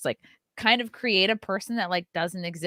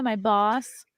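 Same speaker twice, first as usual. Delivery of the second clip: usual speaking voice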